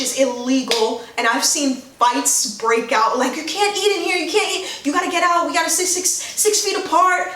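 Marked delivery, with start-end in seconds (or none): 3.4-7.3 high, half-shouted mock scolding; mock scolding order. Restaurant worker scolding customers who eat inside. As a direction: bark the rules at the diners.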